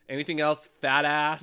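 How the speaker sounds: loud, insulting waiter voice; rude server. Pretend. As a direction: bark it like a nasty waiter. Mocking rude restaurant server.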